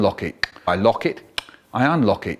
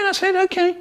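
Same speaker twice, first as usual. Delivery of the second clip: much higher, sing-song okay; sweet innocent child. Retold or imitated. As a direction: play the angel who means no good.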